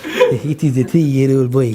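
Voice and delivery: lightly voiced ambassador, low and slow; Iranian ambassador. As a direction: play the ambassador stating it slowly and low. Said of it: "Iranian ambassador called and said".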